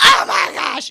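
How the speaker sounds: disgusted shout. Retold or imitated. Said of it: "You were like".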